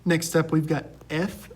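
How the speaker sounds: normal talking voice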